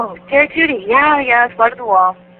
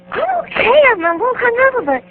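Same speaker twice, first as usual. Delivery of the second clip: very high squeaky voice, slowing at the end; hungover little voice. Retold or imitated. Answering Nick in the elevator, tiny high pitiful voice. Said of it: "And I was like".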